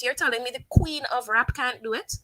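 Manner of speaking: ordinary talk, nothing marked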